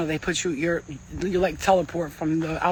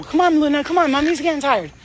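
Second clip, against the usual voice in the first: high, louder call to the dog; 8 semitones higher, 6 dB louder than usual. Real, not acted. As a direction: coax the dog along, a tired mom calling. Calling her dog mid-reading.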